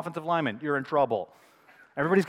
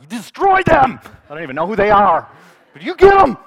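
loud, high fan's war cry; pumped-up shouting; 7 semitones higher, 16 dB louder than usual. Acted. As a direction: yell wildly to egg the team on. Acts out pumped-up fan reaction.

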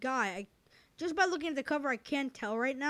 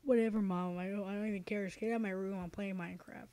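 put-on low, sullen kid grumble; sulky teen voice; 5 semitones lower, 8 dB quieter, faster than usual. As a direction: mutter it like a moody teen. Teen voice telling mom off.

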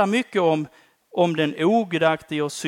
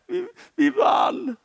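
high, slow triumphant call; joyful cry; slower than usual. Acted. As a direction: lift it up like a victory shout. Believers rejoicing in heaven. (högt, jublande och långsamt)

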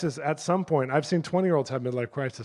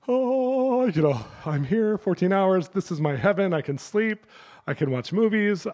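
higher, blissful first-class traveller; acted delight; 6 semitones higher, slower than usual. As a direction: act out settling into luxury with delight.

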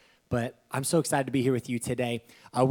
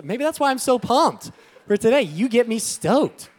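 higher, louder, fired up; pumped up; 10 semitones higher, 9 dB louder than usual. Real, not acted. Pastor getting pumped before preaching, excited. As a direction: let the energy surge.

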